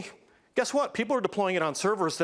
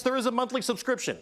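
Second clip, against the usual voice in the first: ordinary talk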